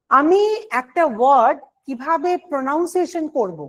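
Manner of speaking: normal talking voice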